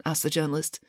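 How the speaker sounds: usual speaking voice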